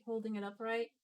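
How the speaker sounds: ordinary delivery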